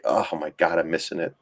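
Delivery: low, muttered mock dismay; lightly voiced sulky trader; deflated regret. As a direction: drop it low like a defeated trader. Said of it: "It's like".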